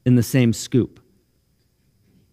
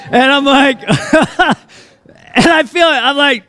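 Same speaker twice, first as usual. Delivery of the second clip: high, loud, teary laughter while telling it; laughing through tears; 11 semitones higher, 4 dB louder, slower than usual. Real, not acted. Pastor retelling son's big tackle, laughing emotionally. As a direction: laugh and choke up at once. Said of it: "why do I get emotional?".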